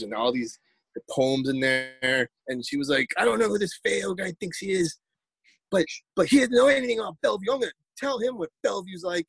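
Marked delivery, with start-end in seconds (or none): 3.2-9.2 high-pitched, worked-up mimicry of a rival; riled-up, high-voiced retold rant; indignant challenge. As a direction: imitate someone indignantly calling a person out. Retells indignant poet's challenge.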